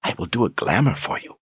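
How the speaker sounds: high, soft coaxing offer. Acted. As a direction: tempt him gently. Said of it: "she offered".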